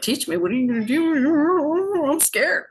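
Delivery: high, slow mock-frightened whimper; mock scared. To the elder offering lessons, a mock-scared whimper. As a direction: play the spooked teen.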